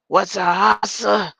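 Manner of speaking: loud, slow rap-star growl. Retold or imitated. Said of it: "In my [name] voice".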